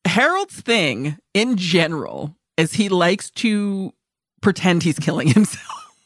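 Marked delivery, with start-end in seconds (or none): 2.7-5.9 breaking into laughter. Real, not acted. Host explaining a grim plot, real laugh breaking in. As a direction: try to stay composed, then laugh through the last word. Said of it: "I should not be laughing already".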